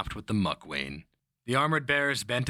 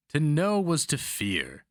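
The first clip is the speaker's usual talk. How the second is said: lightly voiced old mentor, higher and slow; a hint of the old Pick, savoring the maxim; slow, higher-pitched old teacher quoting himself; mentor's saying; 8 semitones higher, 3 dB louder, slower than usual. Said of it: "the old Pick would say".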